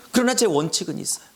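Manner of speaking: ordinary delivery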